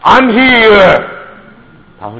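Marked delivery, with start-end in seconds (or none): 0.0-1.0 God's voice. Retold or imitated. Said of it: "上帝说什么".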